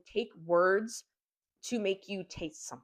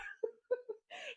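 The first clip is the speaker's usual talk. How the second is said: burst of laughter; 9 dB quieter than usual. Real, not acted. Laughing at the gory turn.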